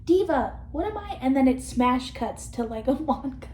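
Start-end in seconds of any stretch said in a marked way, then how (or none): 0.0-1.2 a touch higher, mock helpless; quoting Barbie. Vlogger quoting Barbie asking Diva what she is, mock helpless. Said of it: "and I quote".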